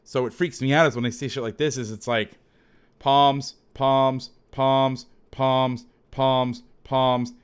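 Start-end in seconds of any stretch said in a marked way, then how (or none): 3.0-7.3 loud, level drone on each repeated word; repetitive monotone. Retold or imitated. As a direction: rattle off identical calls like a machine, evenly spaced. Mimics seeing the same call repeatedly.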